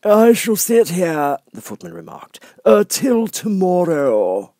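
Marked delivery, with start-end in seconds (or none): 0.0-1.4 high, slow, lofty footman voice; frog footman. Acted. Frog footman dismissing Alice at the door, lofty. 2.7-4.5 high, slow, lofty footman voice; frog footman. Acted. Frog footman dismissing Alice at the door, lofty.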